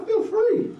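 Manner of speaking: a lifted, exultant retelling of his answer; soaring quote. Retold or imitated. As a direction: let the words fly up high with joy. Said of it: "he's like".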